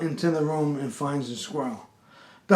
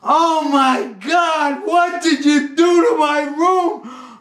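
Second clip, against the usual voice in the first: high, drawn-out mock shriek; screaming; 10 semitones higher, 6 dB louder, much slower than usual. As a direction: scream it in horror, stretching the words. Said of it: "She starts screaming".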